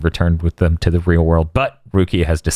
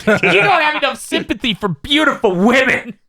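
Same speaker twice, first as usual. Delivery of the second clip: imitating Rangiku. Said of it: "She's like".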